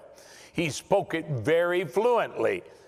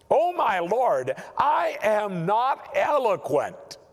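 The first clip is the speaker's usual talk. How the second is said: higher, slow plea of a reluctant Moses; pleading Moses; 5 semitones higher than usual. Acted. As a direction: beg the Lord to let you off. Moses voiced pleading his excuse.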